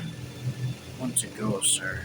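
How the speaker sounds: very soft, low, slow reply; toneless. A narrator's reading. Servant asking officer to leave, toneless and hushed. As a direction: answer meekly without any tone. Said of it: "spoke tonelessly again".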